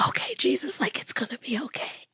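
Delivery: breathless whispered prayer of relief; relieved whisper. Retold or imitated. Wife whispering thanks to Jesus as husband breathes again, hushed whisper. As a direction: whisper it, almost too relieved to voice.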